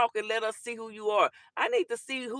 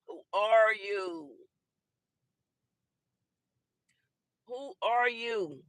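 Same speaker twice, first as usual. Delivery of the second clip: slow taunt; much slower than usual. Acted. Taunting anonymous commenter.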